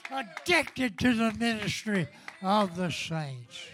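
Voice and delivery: shouted, high ecstatic praise; ecstatic preaching. Acted. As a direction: whoop it up, full revival fire.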